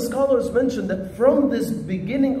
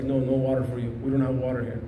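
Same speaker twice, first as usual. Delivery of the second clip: put-on lower, firm refusal from an old teacher; stern sheikh; 8 semitones lower, 4 dB quieter, faster than usual. Sheikh refusing the thirsty student, low and firm. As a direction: deny him flatly, like a strict sheikh.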